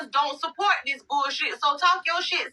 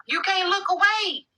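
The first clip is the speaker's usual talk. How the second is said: higher, slow stretched "away"; drawn-out sing-song; 7 semitones higher than usual. Real, not acted. Telling the chat they can't stop watching, stretched and higher. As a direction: draw out the last word like a taunt.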